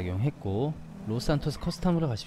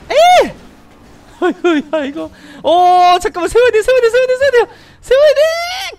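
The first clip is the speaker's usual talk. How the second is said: shrill high yelling; panicked screaming; 14 dB louder than usual. Real, not acted. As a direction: scream to stop the car. Car chaos, must stop.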